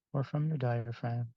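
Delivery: soft, unhurried meditation voice with long pauses; guided meditation. Acted. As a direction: gently guide the group into relaxation, phrase by phrase.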